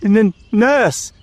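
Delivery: put-on high, frightened child's stammer; faltering. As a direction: falter on the word like a scared boy. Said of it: "faltered Caspian".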